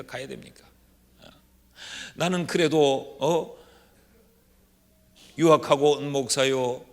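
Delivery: normal talking voice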